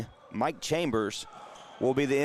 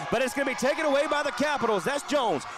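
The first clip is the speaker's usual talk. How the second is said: excited; 10 semitones higher than usual. Real, not acted. Steal in a fast play.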